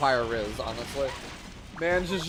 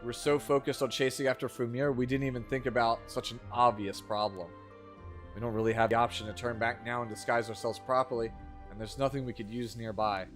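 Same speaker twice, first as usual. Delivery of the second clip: bad acting; 5 semitones lower, 6 dB quieter than usual. Reads game dialogue aloud.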